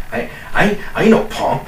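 high, hammed-up tough-guy bluster; mock tough guy. Bragging he won't apologize, hammed-up tough-guy voice. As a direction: puff up like a swaggering punk.

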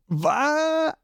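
high startled "Wa?"; shocked. A narrator's reading. Zorro caught off guard, a shocked high squawk. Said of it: "Schockiert sagt Zorro".

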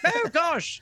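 high mocking sigh; high fake-pitying kids. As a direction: feign sympathy up high. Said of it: "They're like".

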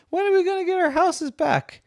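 very high plaintive voice; whining townsfolk. Acted. People in the cave asking animals, high whine. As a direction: whine it up high as an impatient crowd.

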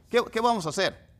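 lightly acted beach question; mock question. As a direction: act out a kid asking what to do. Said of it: "le decimos".

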